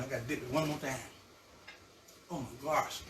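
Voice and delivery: ordinary talk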